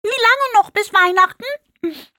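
very high pig voice, talking while chewing. A narrator's reading. Hungry pig asks the family while chewing, very high voice. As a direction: ask with a mouthful, squeaky and impatient. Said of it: "fragte Einschwein kauend".

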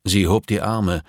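usual speaking voice

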